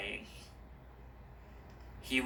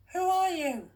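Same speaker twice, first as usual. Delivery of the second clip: put-on much higher, unsure girl's voice; young girl Stella; 5 dB louder than usual. A narrator's reading. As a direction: sound like the hesitant heroine.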